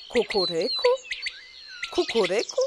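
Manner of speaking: questioning kukuryku with a rising end; mocking question. A narrator's reading. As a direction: echo the rooster's kukuryku with a doubtful rise. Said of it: "kukułka wrzaśnie".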